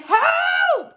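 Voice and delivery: very high, loud panicked cry; cry for help. Acted. Robber Hugh panics on the heaving whale, loud and high. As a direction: yelp as the rock moves. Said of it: "cried Hugh".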